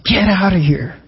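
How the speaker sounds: preacher imitating an indignant shout; mock angry scream. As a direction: act out a righteous scolding without a full scream. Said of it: "he starts screaming at them".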